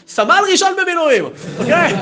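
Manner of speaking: high, loud retort; mock-indignant announcement; acted loud correction. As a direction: shout the rank as a correction. Retold indignant correction, rank shouted.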